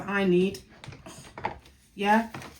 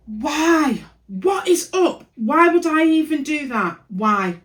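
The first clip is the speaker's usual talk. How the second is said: high, loud what-have-I-done cry; real exasperation at her own mistake; exasperated disbelief; 7 semitones higher, 7 dB louder, slower than usual. Real, not acted. Vlogger spots her own cutting mistake, cries out exasperated. Realising she cut it wrong.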